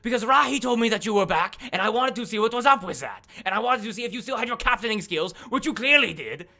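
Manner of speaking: high, loud justifying voice; defensive outburst. Acted. Duke justifying the test to companion, loud defensive protest. Duke explaining in acted quarrel.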